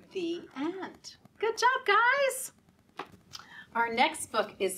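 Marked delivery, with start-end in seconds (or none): 1.4-2.5 cheery praise. Real, not acted. Praising kids after the rhyme.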